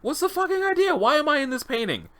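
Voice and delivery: very high, incredulous outburst; alarmed disbelief. Acted. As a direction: demand an answer in high-pitched shock. Subject alarmed at seeing himself.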